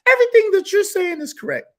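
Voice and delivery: high, gushing sycophant voice; mocking fawning fans in a squeaky tone; mock flattery. Vlogger mocking echo-chamber flatterers, high gushing voice. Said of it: "People want to just hear whatever they're saying is".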